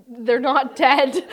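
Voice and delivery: amused slow reassurance; laughing. Real, not acted. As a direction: laugh while reassuring them.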